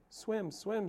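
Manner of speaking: gosling chirp. Acted. Gosling chirping about swimming.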